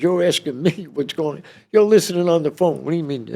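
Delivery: high-pitched, incredulous comeback; indignant protest. Retold or imitated. Inmate answers agents who listened on the phone, indignant. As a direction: turn the question back on them in high protest.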